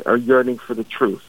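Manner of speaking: ordinary talk, nothing marked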